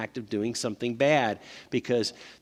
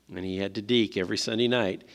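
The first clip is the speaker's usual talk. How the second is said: normal talking voice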